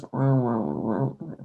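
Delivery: low mock dog grumbling; dog grumble imitation. Retelling how the dog complains when scolded, low grumbling wahs. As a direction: grumble wah like a sulky dog. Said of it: "he has a southern grumble".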